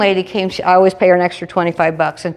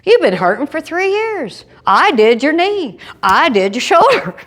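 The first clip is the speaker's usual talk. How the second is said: insistent surgeon; 9 semitones higher than usual. Retold or imitated. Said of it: "He goes".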